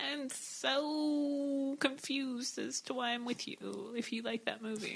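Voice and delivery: very soft, slow, drawn-out disbelief; bewildered mock hush. To a date who loves Joe Dirt, hushed and baffled. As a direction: trail it out softly, utterly baffled. Stunned at a date's taste.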